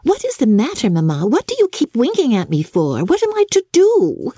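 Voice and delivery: put-on high, puzzled young girl's voice; high innocent daughter asking. A narrator's reading. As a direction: ask it all wide-eyed and innocent. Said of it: "she very innocently said".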